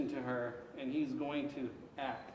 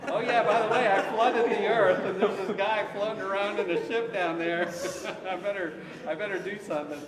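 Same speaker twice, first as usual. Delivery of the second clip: loud, high, laughing mock God; hammed up, giggling through an offhand God; laughing God impression; 6 semitones higher, 9 dB louder than usual. Teacher jokingly voicing God about Noah, loud and laughing.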